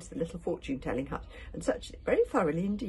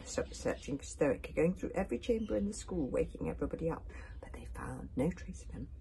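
plain reading voice

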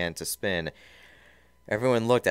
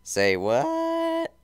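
very high, loud startled cry; shocked; 7 dB louder than usual. Acted. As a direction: squawk it in disbelief. Shocked reaction in story.

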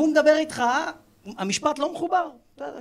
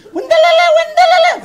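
very high, loud gibberish shriek; shouted mock question; 13 dB louder than usual. Older woman asking her friend a question, high mock shouting. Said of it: "כאילו היא שואלת שאלה".

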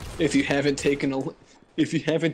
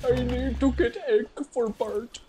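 silly voice; 10 semitones higher than usual. Pretend. Echoing friend's bit.

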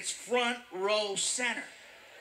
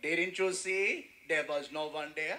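put-on unhurried, lower Indian-accented voice; calm Indian accent; 6 semitones lower than usual. Cab driver excuses running the light, calm Indian accent. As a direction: play an unbothered cabbie with an Indian accent. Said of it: "As calm as can be".

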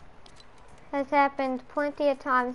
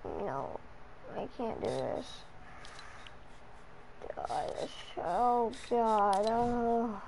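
exhausted moaning; 2 semitones lower, 9 dB quieter, much slower than usual.

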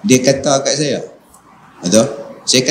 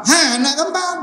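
pitched-up jeering retort; high mocking insult; 13 semitones higher than usual. Ustaz mimics the reply to a taunt, high and mocking.

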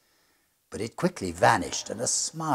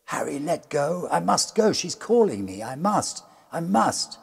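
desperate pleading; 9 semitones higher, 7 dB louder than usual. Acted. Spellbound rector begs to go.

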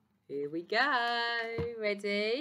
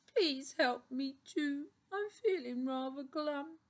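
high, slow, pitiful plea; whimpering hyena; 6 semitones higher, 4 dB quieter than usual. A narrator's reading. Glum Hyena begs the doctor for help, whimpering. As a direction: whimper it as a glum little creature. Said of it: "Hyena whimped".

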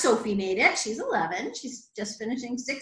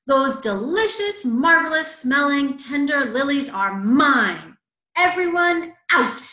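emphatic claim building to a loud order; bossy bully; 3 semitones higher, 7 dB louder, slower than usual. A narrator's reading. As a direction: stress each word, then banish them all. Bully orders everyone out of pond.

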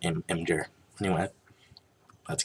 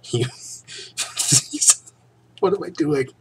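laughing at himself; 8 semitones higher, 4 dB louder than usual. Real, not acted. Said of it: "serious reading of a serious story".

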